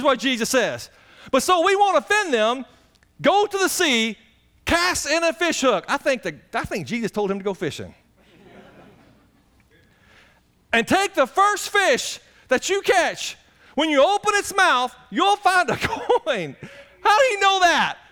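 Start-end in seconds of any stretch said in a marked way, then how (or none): 1.3-14.9 high, loud, urgent sermon voice; full-force preaching. Acted. 15.1-16.6 laughing. Real, not acted. Laughs mid-quote while preaching. 17.0-18.0 high, loud, urgent sermon voice; full-force preaching. Acted.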